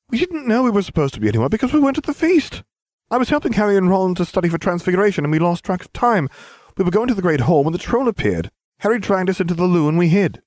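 high rapid eager schoolgirl; fast girl voice. Acted. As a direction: rattle off the excuse. Hermione explaining fast.

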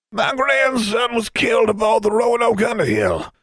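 put-on high, thin old man's voice, dry and matter-of-fact; dry conversational old wizard. Old wizard coldly telling Miss King of his dead grandson. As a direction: say it like discussing the weather. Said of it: "in the same dry conversational tone".

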